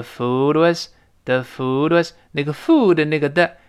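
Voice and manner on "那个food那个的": ordinary delivery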